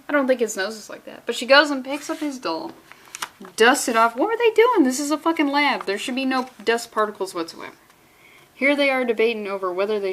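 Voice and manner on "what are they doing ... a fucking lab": high exasperated cry of disbelief; incredulous outburst. Real, not acted. Reader baffled by dust in a lab, incredulous high cry. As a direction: squeal it in disbelief.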